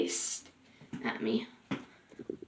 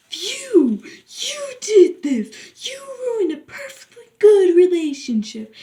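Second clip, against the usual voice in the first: screaming, tearful; 8 semitones higher, 6 dB louder than usual. Retold or imitated. Boy screams at the narrator for ruining his relationship. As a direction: blame her in a tearful rage. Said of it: "screamed at".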